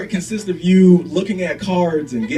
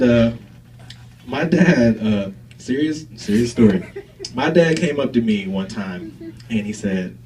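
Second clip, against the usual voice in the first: ordinary talk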